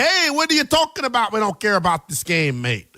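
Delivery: hammed-up Canadian fan protesting, with a Canadian accent that doesn't quite land; bad Canadian accent. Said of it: "That was probably a bad Canadian accent".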